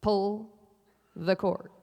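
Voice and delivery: low, deliberate PA instruction; PA announcer. Retold or imitated. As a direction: imitate a deadpan ride operator. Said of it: "comes back on the P A".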